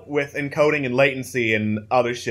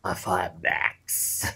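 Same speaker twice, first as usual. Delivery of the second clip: slow, low reptilian voice; lizard folk voice; 8 semitones lower, 6 dB quieter than usual. Acted. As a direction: speak like a wary lizard, slowly. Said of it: "keep doing the lizard folk voice".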